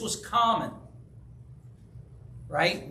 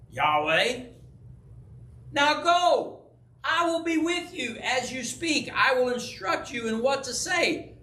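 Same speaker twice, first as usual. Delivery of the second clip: raised, emphatic scripture declamation; God commanding Moses; 6 semitones higher than usual. Acted. God answers the reluctant Moses, commanding. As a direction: build into firm, high-pitched commands.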